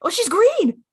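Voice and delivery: high, loud squeal of discovery; mock surprise. Real, not acted. Host realising the character is green, high mock surprise. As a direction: act shocked at the realisation.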